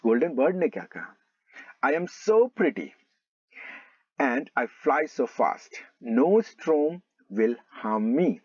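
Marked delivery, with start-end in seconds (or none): 1.8-8.3 slow, measured reading of a boastful line; drawn-out classroom storybook reading; slow story read-aloud. Acted. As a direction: read it out slowly, stretching "so". Reads the proud bird's lines.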